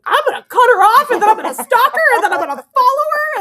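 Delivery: loud, high, revenge-fuelled rant; vengeful rant. Retold or imitated. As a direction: spit out the threats, loud and high. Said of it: "the old me would have been like".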